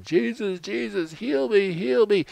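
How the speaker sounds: high, loud begging cry; a pleading crowd cries out to Jesus, high and loud; desperate crowd pleading. Acted. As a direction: call out to Jesus like a sick crowd pushing for healing. Sick crowd begging Jesus for healing.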